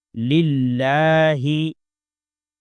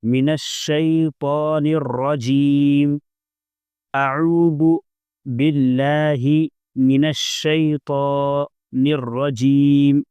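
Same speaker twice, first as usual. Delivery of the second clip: measured, drawn-out recitation; slow recitation; much slower than usual. Shown as a demonstration. Teacher reciting for practice, slow and careful. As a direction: recite slowly for students to copy. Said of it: "اپنے طور پر مشق کرنا ہے".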